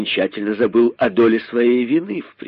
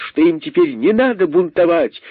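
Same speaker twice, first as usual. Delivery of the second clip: usual speaking voice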